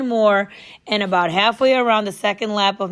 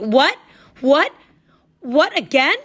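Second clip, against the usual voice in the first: louder, higher disbelieving what; exasperated outburst; 6 semitones higher, 5 dB louder, slower than usual. Acted. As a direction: explode in disbelief. Boy losing it at coach.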